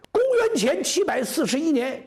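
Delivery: loud-ish, high storyteller's proclamation; high declamation. A narrator's reading. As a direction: ring the year out high and emphatic. Same year declaimed for emphasis.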